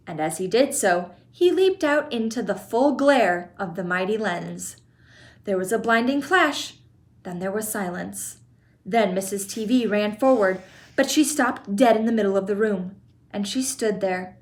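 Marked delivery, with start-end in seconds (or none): none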